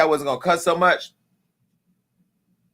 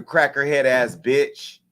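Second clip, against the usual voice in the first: ordinary talk, nothing marked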